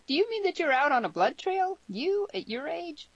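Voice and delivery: put-on very high, incredulous girl; high voice, slowing in disbelief at the end; astonished disbelief. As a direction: question him in shock, pitch soaring. Girl astonished at blood trail.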